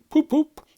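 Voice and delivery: high, loud honking poop poop; car horn. Acted. Toad defiantly mimics a car horn. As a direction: honk like a motor horn.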